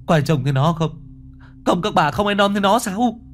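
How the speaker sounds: high, panicked calling out; frightened cry. A narrator's reading. Huy calling out to the mourners in terror.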